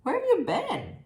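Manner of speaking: bright porcupine question; curious porcupine. Acted. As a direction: call out like a curious friend at the door. Porcupine voiced in story.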